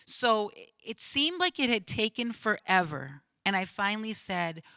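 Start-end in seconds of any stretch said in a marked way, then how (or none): none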